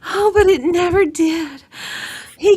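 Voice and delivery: usual speaking voice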